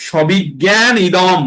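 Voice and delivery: সবিজ্ঞানম said slowly with a full ম; slow split syllables. Shown as a demonstration. Teacher splits the word for students, slowly. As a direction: break it apart slowly. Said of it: "তাহলে কি হবে".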